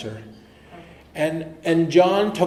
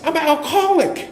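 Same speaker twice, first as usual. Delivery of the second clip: excited announcement; 13 semitones higher, 5 dB louder than usual. Retold or imitated. Proud announcement to Shorty.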